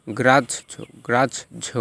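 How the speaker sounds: গ্রাহ্য as "graj-jho"; doubled j. Shown as a demonstration. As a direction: split গ্রাহ্য at the j. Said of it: "হয়ের উচ্চারণ জজ".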